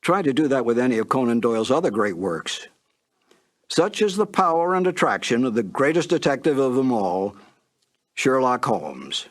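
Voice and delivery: ordinary talk, nothing marked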